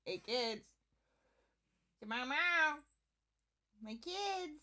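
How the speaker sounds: sing-song call to the cats. Shown as a demonstration. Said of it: "when I call my cats".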